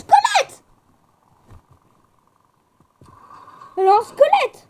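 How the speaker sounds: loud high-pitched yell; astonished shout. Real, not acted. Player echoing a friend's skeleton sighting, astonished shout. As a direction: cry out the shocking discovery.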